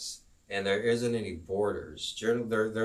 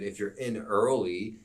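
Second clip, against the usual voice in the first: ordinary talk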